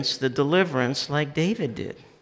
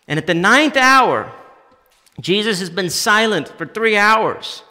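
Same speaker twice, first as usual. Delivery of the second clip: high, loud, urgent preaching; preaching at full force; 7 semitones higher, 9 dB louder than usual. Acted. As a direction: drive it home like a shout. Preaching the cry from the cross.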